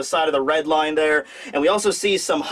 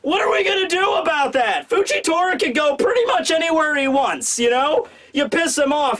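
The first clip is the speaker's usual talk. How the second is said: high-pitched, incredulous rant; exasperated protest; 6 semitones higher than usual. Acted. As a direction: plead the case in disbelief, pitched up high. Voices T-Bone's imagined exasperated protest.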